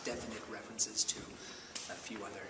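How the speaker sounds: hushed aside. Acted. Keeping plot references secret.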